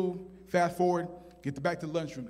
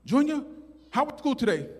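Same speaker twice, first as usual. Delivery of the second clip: higher-pitched imitation of a mother; lightly voiced mom questioning; mom's voice; 7 semitones higher than usual. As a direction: play a mom checking on her kid. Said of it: "She said".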